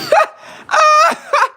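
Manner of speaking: high, loud shrieking laugh burst. Real, not acted. Gamer cracking up, shrieking with laughter. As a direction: let out a high cackling shriek.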